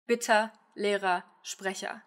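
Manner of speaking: 'Bitte, Lehrer, Sprecher' slowly, each ending in ah; A schwa endings. Shown as a demonstration. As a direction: end 'Bitte', 'Lehrer', 'Sprecher' on a short a. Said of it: "more like an A than an Ö".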